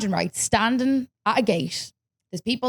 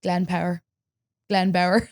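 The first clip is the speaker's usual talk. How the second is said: ordinary talk, nothing marked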